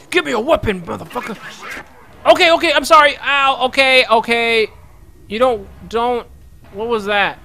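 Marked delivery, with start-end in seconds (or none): 0.1-1.4 higher, louder pushy demand, fading to a mutter; mock demand. Gamer bossing a game guard for a weapon, mock demand. 2.3-6.3 high, loud panicky backing off; mock panic. Gamer pretending to panic before an armed guard.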